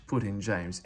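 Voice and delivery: plain reading voice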